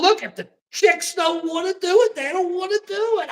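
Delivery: high mocking voice of the chicks; mocking quote. Mocking the women on Fox in a high voice. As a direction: pitch it up to mock them. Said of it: "And I'm going".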